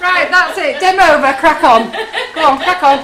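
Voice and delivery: loud, high rallying call; rally everyone loudly. Real, not acted. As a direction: hostess calls the viewers to crack on, loud and high. Calling out to the chat.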